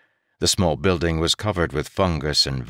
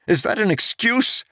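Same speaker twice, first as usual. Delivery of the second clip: much higher, louder indignant retort; angry demand; 4 dB louder than usual. A narrator's reading. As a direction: throw the words back at him. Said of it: "Jarek demanded angrily".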